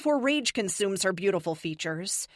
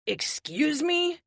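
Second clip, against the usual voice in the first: high, slow, drawn-out disbelieving rise on "me"; incredulous; 9 semitones higher, 3 dB louder, much slower than usual. Acted. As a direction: stretch "excuse me" up in shock. Triple stunned by accusation.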